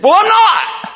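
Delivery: high, loud protest that proves her point; ironic yell. Retold or imitated. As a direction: yell that you're not yelling, with a laugh. Denying yelling to his wife.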